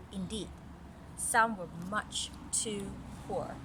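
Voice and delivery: clear, even read-aloud story voice; story narration. A narrator's reading. Mother reading a story to little Caris, storytelling voice. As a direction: read it like a picture book to a child.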